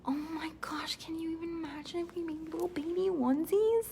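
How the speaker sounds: breathless excited whisper. Real, not acted. Host dreams up baby onesie merch in an excited whisper. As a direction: hush it out like a thrilling secret.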